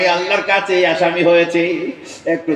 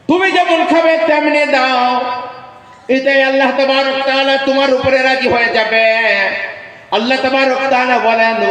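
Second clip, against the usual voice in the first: raised, higher ringing exhortation; preaching at full force; 8 semitones higher than usual. Acted. Preacher urging listeners to give to beggars, raised exhortation. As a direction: lift the voice and urge the crowd.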